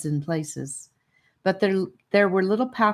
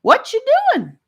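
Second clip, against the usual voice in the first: put-on much higher, loud little girl's voice; high-pitched friendly voice; 11 dB louder than usual. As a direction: pop up with a bright friendly hello. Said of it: "asked a high-pitched, friendly voice".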